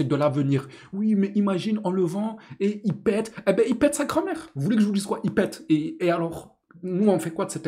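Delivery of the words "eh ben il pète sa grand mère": high, scornful jab; mock the idea with a sneer; sarcastic scoff. Real, not acted. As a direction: fan scoffing at the defender's fans. Sarcastic retort about the player.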